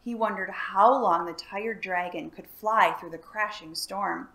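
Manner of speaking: ordinary delivery, nothing marked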